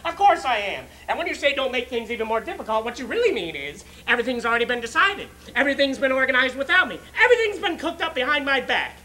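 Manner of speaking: loud, mounting list of grievances; hurt, accusing tirade; aggrieved mother. Acted. Acts out aggrieved mother's rising tirade.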